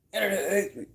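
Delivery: silly put-on voice.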